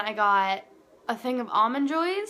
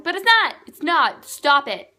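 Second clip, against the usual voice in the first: much higher, louder protest; flustered protest; 6 semitones higher, 10 dB louder than usual. Retold or imitated. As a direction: deny it loudly, caught out. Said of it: "And I was like".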